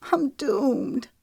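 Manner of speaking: tearful wail, voice cracking; crying despair, hammed a little; voice breaking. A narrator's reading. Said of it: "Her voice broke".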